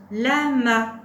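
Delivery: loud, deliberate teacher's model word; syllables held evenly and loudly; word dictation. Shown as a demonstration. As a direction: project each syllable clearly for a learner.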